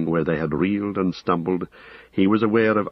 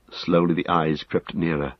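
ordinary delivery, nothing marked

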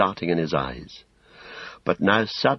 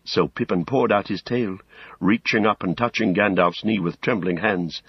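ordinary delivery

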